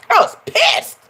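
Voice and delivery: high-pitched indignant squeal; mock indignant. Vlogger retelling her outbreak at church, indignant. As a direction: squeal it in outrage.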